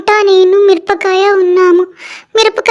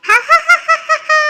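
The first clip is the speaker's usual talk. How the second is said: laughing; 7 semitones higher than usual. Acted. Friends laughing while swimming.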